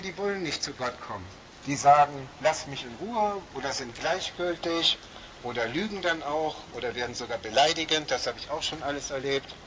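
ordinary talk

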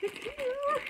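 soft, high squeal; hushed excitement. Real, not acted. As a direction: squeak it very quietly, thrilled. Thrilled as parcel is opened.

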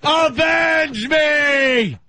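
high, slow, hammed-up dying shout; overacted death scene. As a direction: cry out like a fallen movie hero. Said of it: "Red Dawn".

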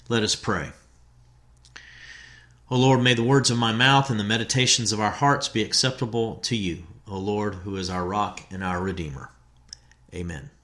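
hushed, reverent prayer voice. Acted. Pastor leading the congregation in prayer, hushed. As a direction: pray softly, fading into amen.

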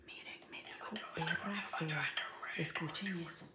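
whispered aside to the viewers; susurro. Real, not acted. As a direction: whisper it like a secret. Secreto contado a los seguidores. (susurro confidencial)

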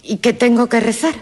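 voz de niña. Acted. Niña pregunta al sacerdote.

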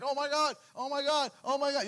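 high, frantic "oh my God"; hammed-up panicked calling on God; mock panic. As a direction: cry out like fires are all around. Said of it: "You're calling on God".

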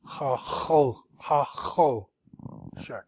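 "Ha ho" with plain H then throaty H; hard H. Shown as a demonstration. Teacher drilling the syllables, hard H on "ho". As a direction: repeat "ha ho" in split syllables, then "check". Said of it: "H and then the hard H".

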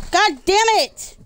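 loud, high angry curse; raging gamer. Real, not acted. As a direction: snap at the game in fury. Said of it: "I'm gonna break my controller".

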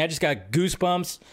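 ordinary talk

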